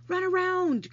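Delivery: high, loud command. Acted. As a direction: order him to run. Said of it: "commanded Charlotte".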